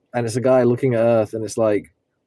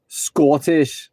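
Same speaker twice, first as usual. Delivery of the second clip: mock Scottish; 6 semitones higher, much slower than usual. Mock accent play.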